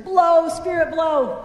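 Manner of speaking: shouted so everyone hears; really loud. Shown as a demonstration. Pastor shouting into the fan for the kids. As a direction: shout it to the back rows. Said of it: "say it really loud".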